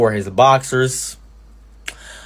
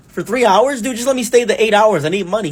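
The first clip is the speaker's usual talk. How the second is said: pleading protest; 10 semitones higher, 4 dB louder than usual. Retold or imitated. Arguing with boss over hours.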